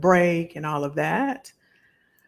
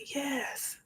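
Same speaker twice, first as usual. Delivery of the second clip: whispered yes; 9 semitones higher, 12 dB quieter than usual. Retold or imitated. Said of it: "And I said".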